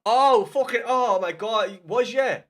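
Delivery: loud, high burst of disbelief; oh my God, caught out loud; shocked outburst. Real, not acted. Realises he was joking.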